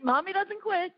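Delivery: pitched high, firm self-encouragement; rally yourself in a high voice; high determined cry. Retold or imitated. Mom cheering herself on at the Lego table, high and firm. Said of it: "And I go".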